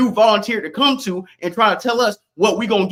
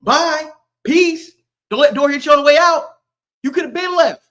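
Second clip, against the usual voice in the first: high, raised-voice taunting farewell; loud dismissal; 6 semitones higher, 3 dB louder than usual. Real, not acted. Streamer sending people out the door, loud taunting dismissal. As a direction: shoo them off loudly.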